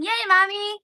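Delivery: mock child's cheer. Kid voice opening the box.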